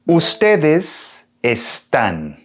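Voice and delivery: Ustedes están said slowly and carefully, each syllable clear; teacher sounding out Ustedes están slowly for learners; slow model pronunciation. Shown as a demonstration. Teacher to Spanish learners, slow careful model reading. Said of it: "Ustedes, you, formal".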